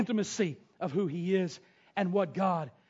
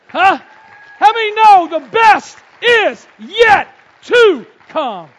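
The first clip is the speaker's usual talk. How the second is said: slow, high, loud call to the crowd; preaching shout; 11 semitones higher, 17 dB louder, slower than usual. Real, not acted. Preacher calling out to his congregation, full-force shout. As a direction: hammer the line home to the church.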